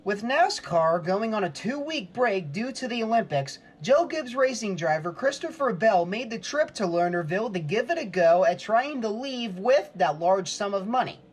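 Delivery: ordinary talk, nothing marked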